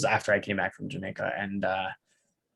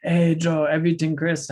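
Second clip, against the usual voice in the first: "Hey, Joe, everything Chris?" called out higher and louder; a friendly coworker hailing Joe across the room, upbeat and louder; imitating coworker's greeting; 7 semitones higher, 4 dB louder than usual. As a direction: call it out like a cheerful Jamaican coworker. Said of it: "he'd be like".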